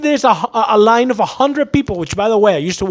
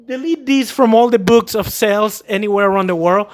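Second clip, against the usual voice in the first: ordinary delivery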